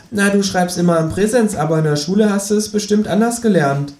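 cocky know-it-all kangaroo; Känguru voice. Acted. Känguru figure voice. (selbstgefälliges Känguru, höher gesprochen)

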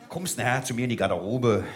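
very low, soft come-on; mock-seductive undertone. Instructor inviting a soldier backstage, low and suggestive. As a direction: drop low and soft, confidential flirting. Mock flirt with audience member.

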